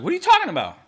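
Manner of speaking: high-pitched incredulous comeback; mock disbelief. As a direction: protest in a high, baffled voice. Retold protest to the coach.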